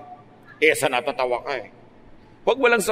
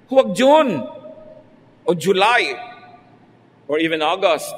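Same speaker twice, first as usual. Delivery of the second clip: sudden raised cry of caution; emphatic loud warning; 2 semitones higher, 5 dB louder than usual. Real, not acted. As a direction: call out the warning. Warning against June weddings.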